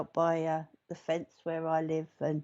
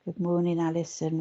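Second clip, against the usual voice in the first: ordinary talk, nothing marked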